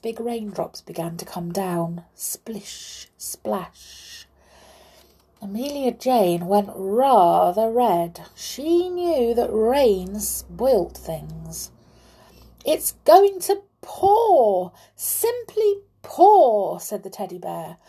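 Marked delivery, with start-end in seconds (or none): 2.1-4.3 hushed, whispered sound words; soft, airy onomatopoeia; whispered raindrops. A narrator's reading. Narrator imitates first raindrops. 12.6-16.8 high, slow, drawn-out bear voice; loud, high-pitched ominous forecast; teddy bear warning. A narrator's reading. Teddy bear warns of downpour.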